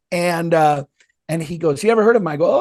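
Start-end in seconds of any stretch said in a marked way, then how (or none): 1.8-2.3 eager stranger. Retold or imitated. Voicing stranger asking again.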